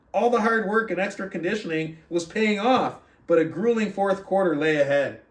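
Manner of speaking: lively read-aloud narration with rising excitement in the game; story reading. Acted. Coach reading the book chapter aloud to his group, animated narration.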